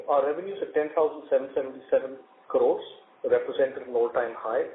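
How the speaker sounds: ordinary talk, nothing marked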